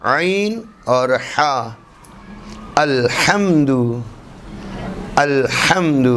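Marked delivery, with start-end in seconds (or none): 2.7-6.1 "الحمد" with a mid-throat Arabic h; pharyngeal ha. Shown as a demonstration. Teacher gives example words for ha, careful throat articulation. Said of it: "دونوں حروف ہمیں مل رہے ہیں".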